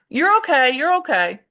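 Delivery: hurried dismissive soothing, not really listening; brisk reassurance. Retold or imitated. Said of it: "You go".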